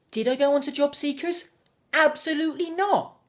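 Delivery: high, loud rhetorical question and answer; indignant refusal. Acted. Refusing to go on job seekers, indignant.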